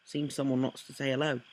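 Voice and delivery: lightly put-on character voice; bad impersonation. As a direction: clumsily voice a story character. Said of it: "That was horrible impersonation".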